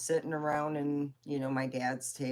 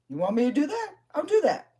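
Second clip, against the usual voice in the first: shouting, much higher and louder; 11 semitones higher, 9 dB louder, faster than usual. Retold or imitated. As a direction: bark the offer at full volume. Dad offering angrily on phone.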